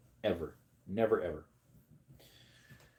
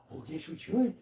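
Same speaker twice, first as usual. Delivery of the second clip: dog's reply voice; 9 semitones higher, 3 dB quieter than usual. Pretend. Answering as the dog.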